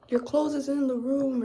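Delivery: lightly voiced Jamaican host. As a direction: call it out like a host. Voicing the Jamaican family.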